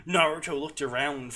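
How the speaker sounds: ordinary talk, nothing marked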